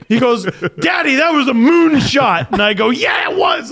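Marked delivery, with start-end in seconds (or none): 0.8-2.5 put-on high-pitched proud kid voice; excited little son. Dad imitating his son after a hit, excited. 2.9-3.7 cheering dad. Retold or imitated. Dad acts cheering his son.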